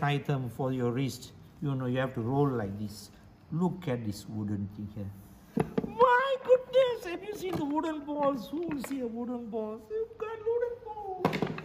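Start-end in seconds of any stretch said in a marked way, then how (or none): 5.9-11.6 very high, stretched-out exclaiming; exaggerated amazement. Showing viewers wooden balls, exaggerated amazement. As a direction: marvel at it in a high-pitched gush.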